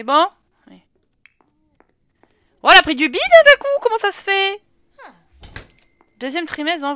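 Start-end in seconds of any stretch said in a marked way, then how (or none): 2.6-4.6 loud, high, fast burst of surprise; startled exclamation. Real, not acted. Streamer spots her character's sudden belly, startled exclamation. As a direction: blurt it out high in astonishment.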